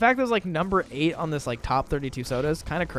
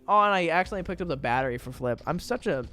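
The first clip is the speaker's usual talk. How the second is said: ordinary delivery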